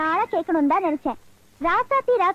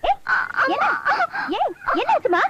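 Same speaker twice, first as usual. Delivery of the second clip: worried calling; 9 semitones higher than usual. Acted. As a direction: urgently ask what's wrong. Asking the crying girl what happened.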